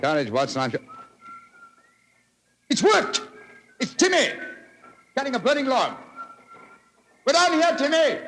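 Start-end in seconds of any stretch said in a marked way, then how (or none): 2.7-8.2 shouting up from the pit, high and loud; calling up. Acted. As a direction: yell up to the boy on the cliff top.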